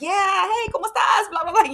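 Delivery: high, chirpy Spanish hello; eager mock greeting. Host imagining greeting a supposed Spanish speaker, eager and high.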